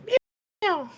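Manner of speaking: very high falling meows; cat meowing. Pretend. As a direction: show off a high cat meow. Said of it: "I'll just show everyone".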